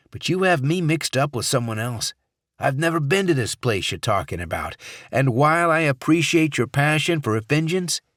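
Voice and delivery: loud, urgent senator; senator, tense but reasoning. Acted. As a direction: try to talk her out of it.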